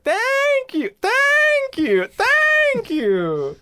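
very high, stretched-out thank-yous; imitated game sound bite. Friend mimicking a seller after each bomb purchase, high squealed thanks. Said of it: "He'll just be like".

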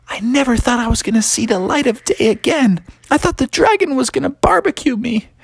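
high-pitched frightened little character; high, fretful storybook voice; scared and relieved. Acted. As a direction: pour out your relief in a high voice. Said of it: "He was so scared".

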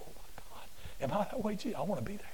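hushed, whispered prayer; whispering to Jesus in wonder; awed whisper. Retold or imitated. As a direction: breathe it out in awe. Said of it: "that makes me go".

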